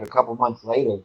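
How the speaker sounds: ordinary talk, nothing marked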